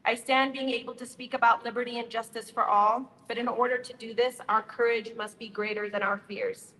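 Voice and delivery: ordinary talk, nothing marked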